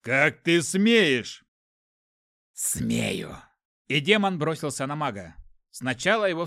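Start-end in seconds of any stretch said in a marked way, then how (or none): 0.0-1.4 loud, very slow outrage; furious. A narrator's reading. Mage rages at the demon. 2.5-3.4 low, defiant demon growl. A narrator's reading. Demon defies the mage.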